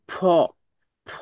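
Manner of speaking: "ফ" released with an audible puff of air. Shown as a demonstration. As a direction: blow air out on "ফ". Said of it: "বাতাস বের হইতেছে". (বাতাস বের করে "ফ")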